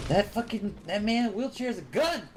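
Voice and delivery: high startled yell; shocked outburst. Real, not acted. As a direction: blurt it out in shock. Startled by enemy in game.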